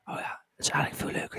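unvoiced whisper, very soft. Real, not acted. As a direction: whisper it so Julia can't hear. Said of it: "moet je niet hard zeggen".

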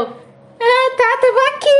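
high, sheepish fake-casual reply; hammed-up embarrassed excuse; flustered cover-up.

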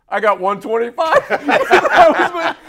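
high, loud and slow, cracking up; can barely get it out for laughing; punchline swallowed by real laughter. Real, not acted.

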